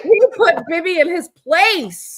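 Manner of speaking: slow, loud, high mock pride; mock maga hype. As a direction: ham up the fake triumph. Mocking maga claims back.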